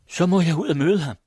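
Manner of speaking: high, loud, joyful call; glad cry. Acted. Cecilia cries out gladly, rushing to meet Esben. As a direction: burst out happily, voice up high. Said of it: "råbte hun glad".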